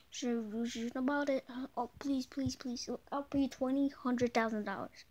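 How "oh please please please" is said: ordinary talk, nothing marked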